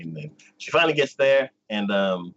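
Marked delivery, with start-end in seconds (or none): none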